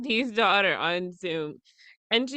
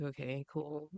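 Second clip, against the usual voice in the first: much softer, giving up on the drink; resigned murmur; 8 semitones lower, 16 dB quieter than usual. Retold or imitated. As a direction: let it go with a defeated hush. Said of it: "I'm like".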